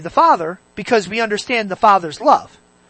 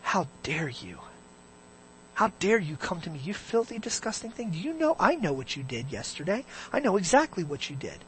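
condemning God; 10 dB quieter than usual. Acted. Imagined God rebuking a praying sinner, quiet condemnation. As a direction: condemn them very softly, voice held down. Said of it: "as though God looks at you and goes".

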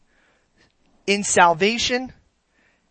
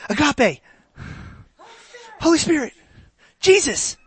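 mock eager answers; 9 semitones higher than usual. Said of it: "Everybody yells the same answers".

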